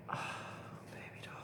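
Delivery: soft whispered pet name; whispered endearment. Real, not acted. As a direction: coo it under your breath. Whispered aside to makeup.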